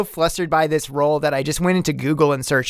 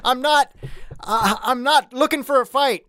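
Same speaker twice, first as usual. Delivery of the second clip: high earnest pleading; 8 semitones higher than usual. Acted. Dak reasoning with runaways.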